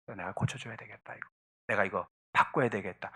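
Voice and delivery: very soft inner voice; quiet self-realization. Acted. Spouse privately deciding to change a habit, quiet resolve. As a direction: think it to yourself, low and hushed.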